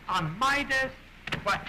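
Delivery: high, incredulous double take; shocked disbelief. Acted. Repeated with a high shocked shout.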